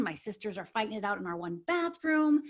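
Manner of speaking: normal talking voice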